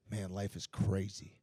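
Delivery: low, slow, soft musing; quiet wonder, reenacted. Sore fighter looks up at the moon, low hushed musing. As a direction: murmur it slowly to yourself.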